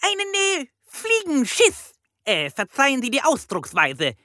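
very high, croaky bird voice; piercing parrot squawk. A narrator's reading. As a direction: report excitedly like a parrot police chief. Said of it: "krächzte der Papagei".

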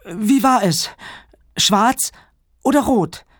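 put-on high, anxious little girl voice; worried child. A narrator's reading. Hannah asks Papa about the monster, high and worried. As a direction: ask urgently in short high bursts. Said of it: "fragte Hannah besorgt".

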